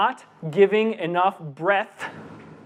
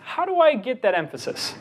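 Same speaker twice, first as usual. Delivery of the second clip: HOW pitched high, then falling through the question; "How" stressed as the point of the question; emphatic question; 6 semitones higher than usual. Shown as a demonstration. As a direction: hit "How" hard and high, let the rest fall. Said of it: "you emphasize the question".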